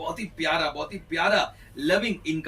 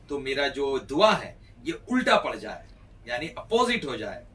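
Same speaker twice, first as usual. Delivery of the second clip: ordinary delivery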